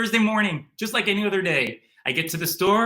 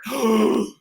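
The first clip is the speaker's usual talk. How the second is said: sharp gasp; 5 semitones higher, 5 dB louder than usual. Retold or imitated. Learns Oprah is not there.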